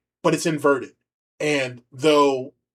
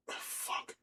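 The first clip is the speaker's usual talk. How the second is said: whispered curse; 20 dB quieter than usual. Real, not acted. Muttered curse after a stumble.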